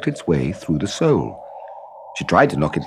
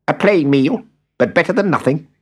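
little man voice; 8 semitones higher than usual. A narrator's reading. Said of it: "said the little man".

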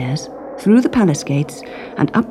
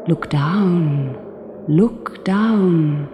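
north wind voice; much slower than usual. A narrator's reading. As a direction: call it like the wind.

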